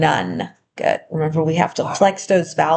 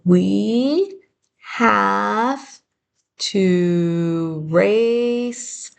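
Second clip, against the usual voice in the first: stretched, very slow dictation; much slower than usual. Shown as a demonstration. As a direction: read it out word by word for writing. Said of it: "write the first sentence".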